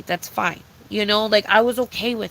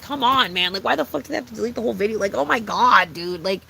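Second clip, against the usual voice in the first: usual speaking voice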